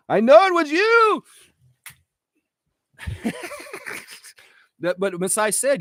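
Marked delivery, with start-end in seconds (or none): 0.1-1.2 hammed-up accusing cry, pitched way up; mock accusation, high. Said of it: "reenact the phone call". 3.0-4.0 very high, soft squealing laugh. Real, not acted. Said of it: "laughs after reenactment".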